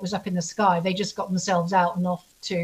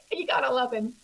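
ordinary talk, nothing marked

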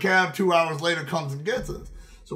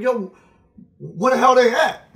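put-on higher, louder shout for his boys; calling out; 7 semitones higher, 7 dB louder than usual. Searching the house for his friends, calling out loudly. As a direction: yell across the house for your friends.

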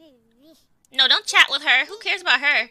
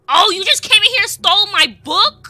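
much higher and louder, indignant; outraged accusation; 11 semitones higher, 13 dB louder than usual. Pretend. Player accusing the Sim of stealing her book, loud and high. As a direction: accuse them loudly.

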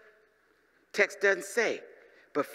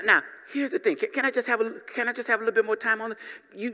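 ordinary talk

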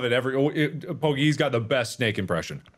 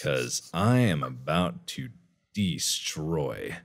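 low menacing; 6 semitones lower, 5 dB quieter than usual. Pretend. Sinister follow-up.

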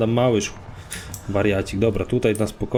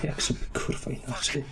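quiet resigned aside; 11 dB quieter than usual. Real, not acted. Player to himself after a setback, quiet resigned aside. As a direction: grumble it almost without voice.

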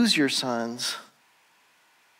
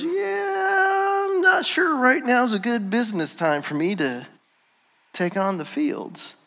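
high, strained, squirming excuse; awkward backing out; 8 semitones higher than usual. Retold or imitated. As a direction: squeeze the excuse out like a cornered man. Relative backs out awkwardly.

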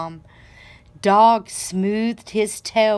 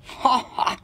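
donkey laughing; 4 semitones higher than usual. A narrator's reading. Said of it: "Donkey overheard Pig and laughed".

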